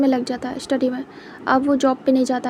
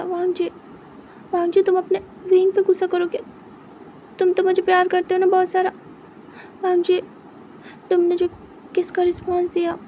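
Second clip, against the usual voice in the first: higher, coaxing plea; pleading boyfriend; 5 semitones higher than usual. Acted. Wing begging Wang ji to meet once, higher pleading voice. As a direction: coax him back, begging sweetly.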